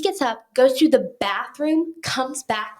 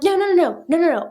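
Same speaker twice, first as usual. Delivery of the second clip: loud, raised, rapid string of no's; insistent no; 6 semitones higher, 7 dB louder, faster than usual. Retold or imitated. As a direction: imitate him cutting her off loudly. Andrew insists, cutting her off.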